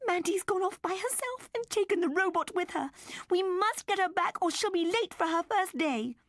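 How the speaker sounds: high anxious mother; urgent. A narrator's reading. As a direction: urgently call her husband. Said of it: "called urgently".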